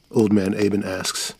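usual speaking voice

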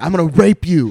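hammed-up loud intimidation; mock threat. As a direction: shout it like an inmate scaring kids. Imitating inmate threatening a kid.

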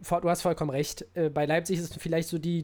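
usual speaking voice

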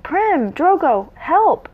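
high, restrained read-aloud scream. A narrator's reading. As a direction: cry for help without full volume. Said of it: "Marigold screamed".

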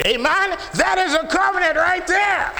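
high, loud preacher's shout; full-force pulpit cry; preaching shout. Real, not acted. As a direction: thunder it out to the congregation. Preacher erupts over the covenant.

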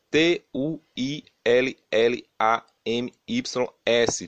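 name spelled out letter by letter, slow; spelling it out. Shown as a demonstration. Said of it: "Vou soletrar para vocês".

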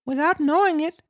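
very high puppet voice; Pinocchio explaining. Acted. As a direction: explain as a squeaky little marionette.

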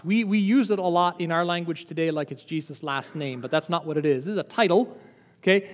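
ordinary talk

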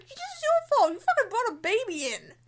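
high, loud, furious outburst; exasperated outburst. Retold or imitated. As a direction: yell at them for bringing a baby. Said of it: "I'm just like".

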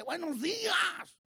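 high, drawn-out sing-out hello; acted greeting. Retelling a coworker greeting people who ignore him, acted call.

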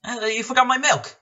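high, loud demanding yell; shouting. Retold or imitated. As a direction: bark for the missing milk. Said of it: "started, like, shouting".

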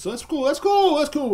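high, loud, overdone insistence; fake-cool protest. Snubbed by family for meth heads, loud fake-cool protest. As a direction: insist far too hard that you don't mind.

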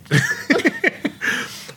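long high laugh; high drawn-out laugh. Real, not acted. Laughs after joke.

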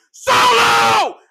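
imitating a furious bellow, drawn out and very loud; roared summons. Retelling the boss summoning his enforcer, roared. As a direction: bellow the name like a boss's order. Acts out Roman bellowing the order.

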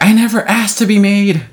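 high-pitched, melodramatic quote; mock wounded retort. Acting out the clone's comeback, mock wounded. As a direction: protest like a hurt character. Acts out character's upset retort.

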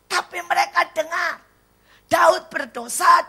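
high, loud, emphatic sermon delivery; full-force preaching. Real, not acted. Preacher telling congregation what children heard, full-force preaching.

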